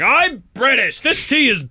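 high, hammed-up British accent; snobbish Brit. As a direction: reject it as a pompous British snob. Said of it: "I'm gonna be like".

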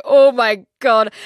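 loud, slow gasp of horror; retold shock. Teen waking beside a stranger, loud and slow in horror. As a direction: drag it out in dread.

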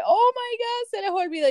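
very high-pitched mock exclamation; husband's amused exclamation. Husband reacting to the forgotten verdict form, high-pitched excitement.

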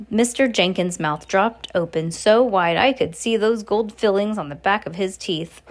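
usual speaking voice

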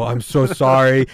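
loud, slow, drawn-out apology; mock apology. Friend suddenly apologizing after the attack, hammed-up and loud.

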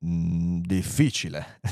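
low, drawn hum of thought; pondering computer. Acted. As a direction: mull it over deep in the voice. Computer ponders the hard question. (computer grave e pensieroso)

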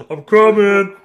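loud, high hammed-up bellow; mock Big Show bellow. Host voicing Big Show lamenting offstage, loud bellow. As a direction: roar it from the background like a giant. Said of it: "Big Show in the background lamenting".

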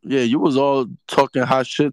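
ordinary talk

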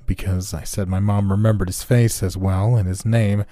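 plain reading voice

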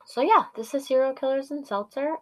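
normal talking voice

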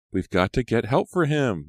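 high, loud, worried plea; urgent. A narrator's reading. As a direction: urge them to get help. Maya urges help for the injured.

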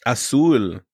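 "Azul" said the Spanish way, ah-SOOL; Spanish pronunciation. Shown as a demonstration. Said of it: "Spanish for, like, blue".

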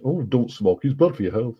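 imitated nagging non-smoker; mock preachy. Smoker mimicking non-smokers who preach, mock preachy. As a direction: preach it in mild mockery. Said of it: "these non-smokers that preach".

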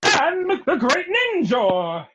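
high, slow heroic ninja voice; Great Ninja voice. Acted. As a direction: proclaim it like a hero. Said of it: "our best voice impressions of".

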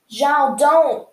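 desperate call, Zhao don't; pleading shout. Acted. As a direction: shout to stop him before he strikes. Aang begs Zhao to stop.

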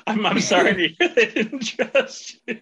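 slow words broken up by laughter; mock apology dissolving into laughter; laughing. Real, not acted. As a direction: laugh through a teasing apology. Host teases guest while laughing.

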